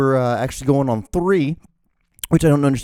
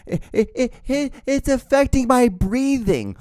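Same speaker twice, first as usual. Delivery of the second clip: mocking whiny voice; 11 semitones higher than usual. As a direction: whine it like a spoiled protester. Mocking anti-masker complaint.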